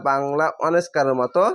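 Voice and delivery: usual speaking voice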